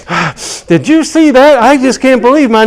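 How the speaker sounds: high loud thrilled bragging; bragging old lady. Retold or imitated. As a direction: crow with delight like an old lady walking off. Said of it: "from her going".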